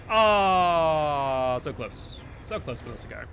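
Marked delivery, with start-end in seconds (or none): 0.1-1.6 frustrated groan. Real, not acted. Just missed the escape.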